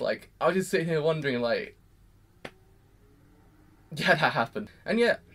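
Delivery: usual speaking voice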